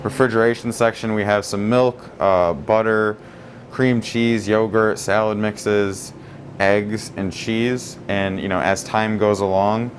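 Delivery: ordinary talk, nothing marked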